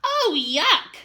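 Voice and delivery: high, loud disgusted shriek. Acted. Chester disgusted by worms, loud high shriek. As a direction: squeal it grossed out. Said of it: "shrieked Chester".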